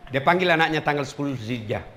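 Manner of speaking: normal talking voice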